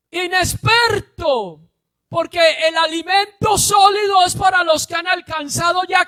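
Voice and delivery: loud, high preacher's shout; preaching at full force. Acted. Preacher shouting the point to his congregation. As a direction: drive it home to the congregation.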